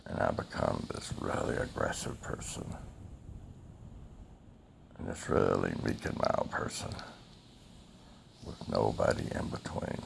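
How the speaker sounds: hushed confession. Real, not acted. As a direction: barely breathe the words out. Painful confession ending the story.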